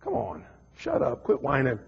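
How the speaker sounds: low, soft mock scold; wife scolding. Wife dismissing her husband, low and curt. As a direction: brush off the whining. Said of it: "she's like".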